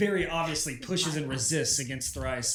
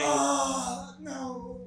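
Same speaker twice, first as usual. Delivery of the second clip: high, drawn-out fake wail; badly faked cry of distress; mock dismay; 10 semitones higher than usual. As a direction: wail it out as a show for onlookers. Feigns distress under the fallen body.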